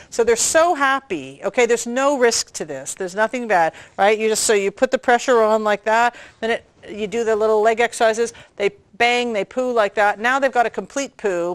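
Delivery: ordinary talk, nothing marked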